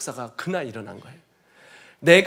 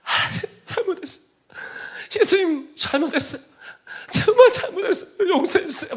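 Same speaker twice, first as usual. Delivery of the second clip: much higher, tearful, louder cries; sobbing plea; 12 semitones higher, 5 dB louder than usual. Retold or imitated. Man at the back pounding the floor, weeping to Jesus. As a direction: beg for forgiveness through sobs. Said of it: "땅을 쳐가면서".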